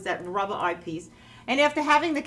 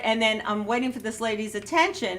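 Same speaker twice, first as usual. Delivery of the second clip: ordinary talk, nothing marked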